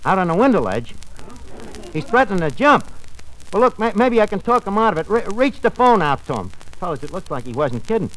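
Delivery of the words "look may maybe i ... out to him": normal talking voice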